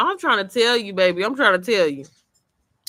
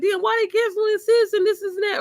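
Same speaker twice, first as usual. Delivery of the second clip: shocked dismay; 13 semitones higher than usual. Retold or imitated. Imitating her own shocked reaction.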